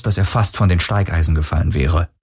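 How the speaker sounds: ordinary delivery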